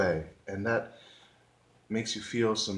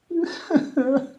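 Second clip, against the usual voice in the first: high, wailing mock sobs; hammed-up boo-hooing; fake cry; 4 dB louder than usual. Trying once more as the crying grapefruit, fake cry. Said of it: "I can't mime crying right now".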